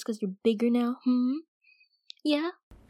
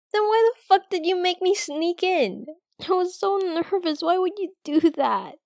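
annoyed; 7 semitones higher, 4 dB louder than usual. Acted. Annoyed at being made to sneak.

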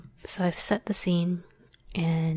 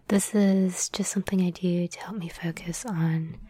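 ordinary delivery

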